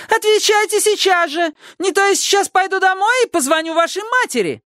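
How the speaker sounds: put-on very high shrieking old lady; shrieking neighbour. A narrator's reading. Neighbour screeches at the children, very high voice. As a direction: screech the question. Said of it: "верещала миссис Фонтейн".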